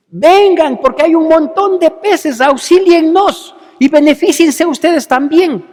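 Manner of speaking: loud, high call across the water; calling out to the other boat. Acted. Fisherman calling the other boat over, loud. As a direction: shout for the others to come help.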